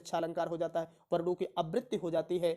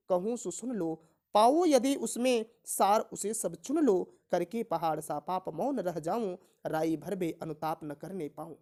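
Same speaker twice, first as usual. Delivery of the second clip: slow, measured reading of verse; declaimed classroom poem, unhurried; poem recitation; 3 semitones higher, 4 dB louder, slower than usual. Acted. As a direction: recite the verse line by line, drawing out the words.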